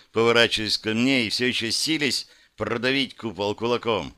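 ordinary delivery, nothing marked

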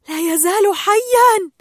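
high, loud, stretched-out cheer; jubilant. A narrator's reading. Tank fish see Nemo alive, loud jubilant cheer. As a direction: cheer it out with joy. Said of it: "فابتهج سكان الحوض".

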